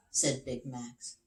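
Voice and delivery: ordinary delivery, nothing marked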